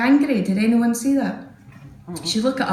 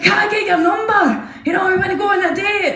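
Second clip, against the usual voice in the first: eager lad; 9 semitones higher than usual. Retold or imitated. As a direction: blurt the ask for a date. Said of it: "He's like".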